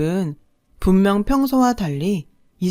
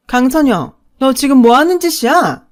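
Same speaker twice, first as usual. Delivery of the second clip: much louder, higher angry bellow; sudden yell; 6 semitones higher, 8 dB louder than usual. Acted. Mother catches daughter packing, sudden furious yell. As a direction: bark her full name in outrage. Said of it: "버럭 소리를 질렀어요".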